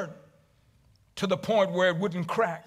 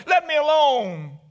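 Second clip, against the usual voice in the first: mock yell; 8 semitones higher, 5 dB louder than usual. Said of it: "and I yelled".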